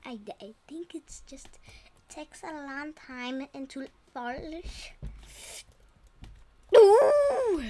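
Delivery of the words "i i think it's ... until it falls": soft unvoiced whisper. Real, not acted. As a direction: whisper it to yourself. Hushed explanation while playing.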